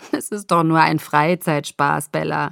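amused reassurance after a chuckle; lightly amused, easygoing explanation; laughing. Acted. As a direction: laugh off the worry. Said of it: "Jacob lachte".

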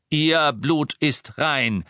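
booming echoing villain monologue. Acted. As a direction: boom it through the hall. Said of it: "seine Stimme dröhnte eindrucksvoll".

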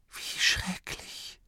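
slow, voiceless, hushed horror; breathed whisper. A narrator's reading. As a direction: breathe it out very softly and slowly. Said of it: "Schließlich hauchte Grimma". (entsetzt gehaucht, ganz leise)

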